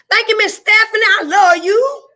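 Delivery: high, loud cry of love to a viewer; overjoyed shout of affection; gushing shout. Real, not acted. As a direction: call out your thanks with feeling.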